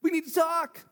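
high, fast, bubbling with news; excited. Shown as a demonstration.

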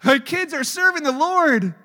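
loud mocking parental pride; mock proud parent. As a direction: parody a boasting parent. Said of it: "You know".

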